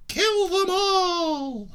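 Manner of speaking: very high, very slow puppet screech; sinister sock puppet. Acted. Missus Sock answering the drunk captain, high and slow. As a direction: let the sweet puppet turn murderous. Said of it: "what do you think, Missus Sock?".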